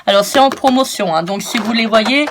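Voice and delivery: normal talking voice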